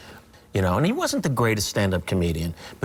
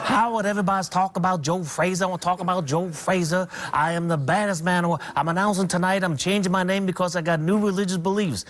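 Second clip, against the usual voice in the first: higher boastful champ voice, lightly done; light Ali impression, higher and cocky; Muhammad Ali; 8 semitones higher than usual. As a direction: brag to the room like the young champ. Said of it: "he does this really good Ali imitation".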